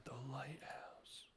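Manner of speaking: slow, breathy-soft whisper of the word; mysterious hush. Acted. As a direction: whisper it like a secret clue. Echoing a whispered game line.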